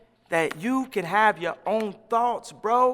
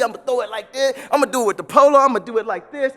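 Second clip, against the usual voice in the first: ordinary talk, nothing marked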